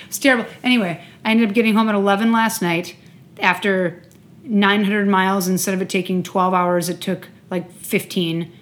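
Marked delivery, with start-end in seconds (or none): none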